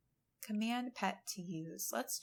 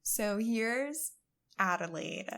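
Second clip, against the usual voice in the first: usual speaking voice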